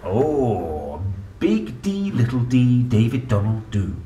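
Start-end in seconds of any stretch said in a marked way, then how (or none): none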